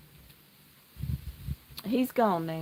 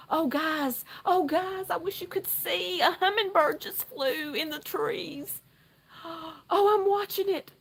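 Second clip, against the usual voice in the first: much higher, awed and breathless with a gasp; hushed thrill; 9 semitones higher than usual. Real, not acted. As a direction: marvel at a tiny bird. Hummingbird lands near the deck.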